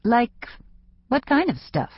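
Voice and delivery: stall, then ask warily in a high voice; hesitant. A narrator's reading. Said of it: "Laura hesitated".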